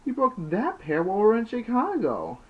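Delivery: high, loud, exasperated explaining. Acted. Snake reminds Larry he broke the glasses, high and loud. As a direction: fire it back high and loud. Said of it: "Snake explains".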